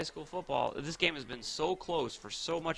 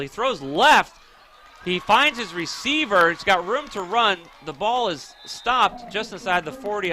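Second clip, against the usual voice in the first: excited play-by-play; 5 semitones higher, 7 dB louder than usual. Real, not acted. Calling a live pass play.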